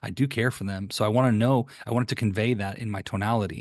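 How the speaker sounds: ordinary delivery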